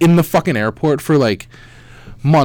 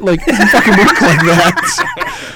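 laughing; 8 semitones higher, slower than usual. Real, not acted. Laughing through the retold flight.

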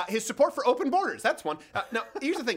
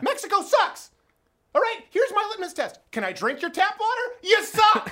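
mock rant; 11 semitones higher, 5 dB louder than usual. Real, not acted. Ranting at Mexico.

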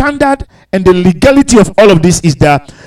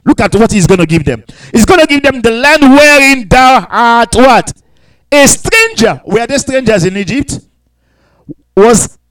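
ordinary delivery

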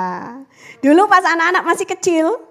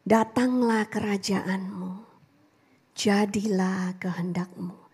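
slow, hushed prayer whisper; slow reverent whisper; 6 semitones lower, 10 dB quieter, slower than usual. Acted. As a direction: let each word fall softly, slowly. Quoting Lord's Prayer.

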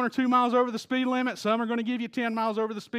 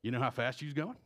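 low, quiet state trooper voice; Don in state trooper mode; 7 semitones lower, 10 dB quieter, faster than usual. Retold or imitated. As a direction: imitate a trooper, pitched down and understated. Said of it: "went back into state trooper mode".

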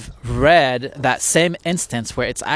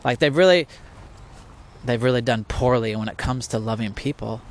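usual speaking voice

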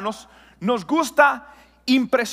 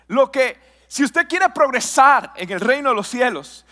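ordinary talk, nothing marked

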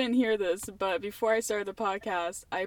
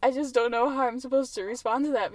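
ordinary talk, nothing marked

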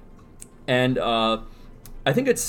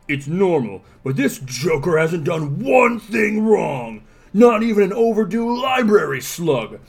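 stern Dredd voice; 3 dB louder than usual. Acted. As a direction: lay down the law, growing stern. Dredd's speech read aloud.

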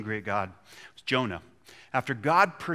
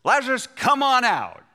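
high, loud summons; calling out; 10 semitones higher, 6 dB louder than usual. Pretend. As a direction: call into the tomb. Jesus calls Lazarus from tomb.

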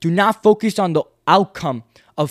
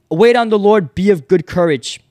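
loud, higher call of faith; shouted declaration, firm and meant; louder, like you mean it; 5 semitones higher, 8 dB louder than usual. Shown as a demonstration. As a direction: proclaim it out loud to the crowd. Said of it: "Say it louder".

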